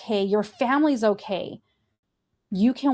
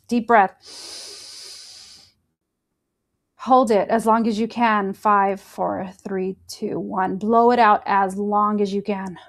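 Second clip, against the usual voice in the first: guided breathing. Acted. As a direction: talk them through each breath. Leads a breathing exercise.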